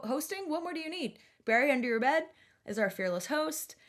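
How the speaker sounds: usual speaking voice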